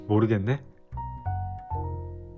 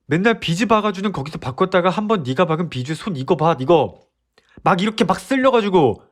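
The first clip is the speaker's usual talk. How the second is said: higher, louder, rapid scolding; exasperated rant; 6 semitones higher, 3 dB louder than usual. Acted. Boyfriend listing girlfriend's changes, loud and exasperated. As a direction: nag her out of frustrated care.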